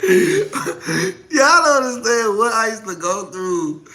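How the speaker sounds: slow, words through laughter; laughing. Real, not acted. Telling followers what she went through, laughing.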